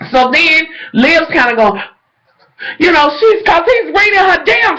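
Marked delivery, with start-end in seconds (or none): 2.8-4.8 excited high shout. Real, not acted.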